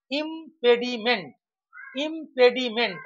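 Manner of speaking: ইমপেডিমেন্ট in separated beats; broken into syllables. Shown as a demonstration. Teacher demonstrating syllables to students, ইমপেডিমেন্ট divided up. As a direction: sound out ইমপেডিমেন্ট piece by piece. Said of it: "তিনটি syllable থাকে".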